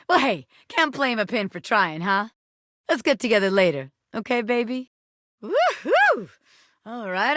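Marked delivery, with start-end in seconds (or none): 5.4-6.3 high excited whoop. Acted. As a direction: let out a thrilled cheer. Character whooping.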